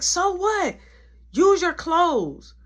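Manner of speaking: high, dismissive retort; mad girl. Retold or imitated. Annoyed girl answering the cold complaint, high angry retort. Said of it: "other girl was getting mad".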